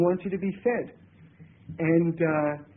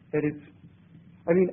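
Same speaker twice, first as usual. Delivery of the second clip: usual speaking voice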